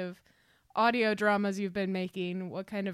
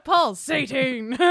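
loud, laughing Paul Sading with stretched Paul; enthusiastic; 3 semitones higher, 7 dB louder, much slower than usual. Pretend. Host shouting guest's name enthusiastically on request. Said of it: "say it enthusiastically like that".

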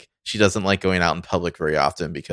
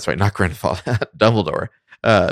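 usual speaking voice

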